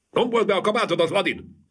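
high, bossy woman's call; high wife fussing at her husband. A narrator's reading. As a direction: fuss at him from across the room.

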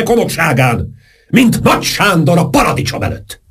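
put-on high, grandiose old count declaiming; lofty speech. A narrator's reading. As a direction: orate nobly as the pompous count. Saint-Germain's lofty speech. (magas, fennkölt szónoklás)

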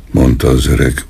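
plain reading voice

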